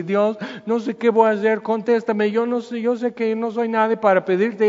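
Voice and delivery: desperate prayer. Retold or imitated. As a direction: imitate a helpless believer crying out. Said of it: "Nunca te has estado ante de Dios así".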